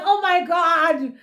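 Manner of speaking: delighted laughing oh my God; laughing squeal. Retold or imitated. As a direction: giggle the words out in delight. Giggling at Andile's comeback.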